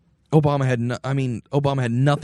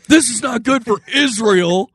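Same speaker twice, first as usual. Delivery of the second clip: high, loud, drawn-out warning; hammed-up cry of alarm; mock-alarmed pundit; 9 semitones higher, 5 dB louder than usual. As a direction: declaim it slowly like an overwrought commentator. Joking pundit impressions, laughter after.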